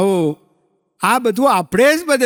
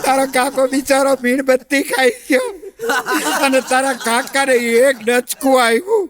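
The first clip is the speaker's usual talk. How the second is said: hammed-up tearful wail, higher; mock crying; 5 semitones higher than usual. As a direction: fake weeping over her sorrows. Mock tearful lament about husband. (બનાવટી રડતો અવાજ)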